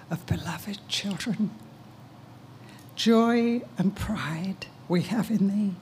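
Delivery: breathy whisper, slow; whispered verse. Real, not acted. Mother whispering a blessing to her son. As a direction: breathe these lines of the poem. Soft lines inside the poem.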